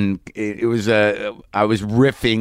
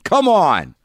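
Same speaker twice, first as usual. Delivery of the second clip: incredulous; 5 semitones higher, much slower than usual. Retold or imitated. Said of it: "And I'm like".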